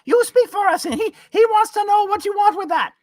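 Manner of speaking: very high, squeaky goblin voice; little fire goblin. Acted. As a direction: call out like a tiny excited imp. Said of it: "one of them comes up and is like".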